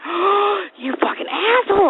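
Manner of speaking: high-pitched, aghast indignation; mock outrage. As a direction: gasp it out high, as if betrayed. Acted outrage at toddler stealing teeth.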